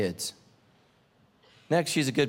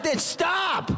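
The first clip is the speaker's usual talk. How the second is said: shouted retort; 12 semitones higher than usual. Retold or imitated. Stranded man shouting at the driver to stop, comic yell. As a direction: yell back to stop her.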